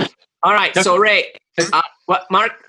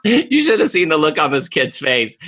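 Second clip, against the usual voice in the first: ordinary delivery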